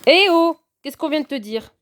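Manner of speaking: sharp, loud high scolding; a real, loud telling-off for a misbehaving cat. Real, not acted.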